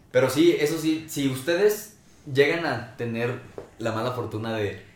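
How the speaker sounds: ordinary talk, nothing marked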